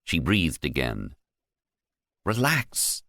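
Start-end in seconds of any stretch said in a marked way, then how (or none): 2.2-3.0 squeaked. Acted. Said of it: "Tony squeaked".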